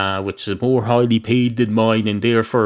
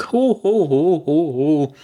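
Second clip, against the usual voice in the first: mock ho ho laugh; 7 semitones higher, slower than usual. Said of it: "laughing at own joke".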